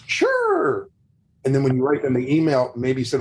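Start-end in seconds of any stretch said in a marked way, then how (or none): 0.1-0.9 a much higher, falling "Sure."; eager sure. Retold or imitated. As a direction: squeak out "Sure." way up high as the delighted client. Client voiced agreeing eagerly.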